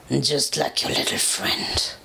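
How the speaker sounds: deep, slow, breathy threatening whisper; furious dragon whisper. A narrator's reading. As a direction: hiss the taunt low and slow like a dragon. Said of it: "he growled, fury in his voice".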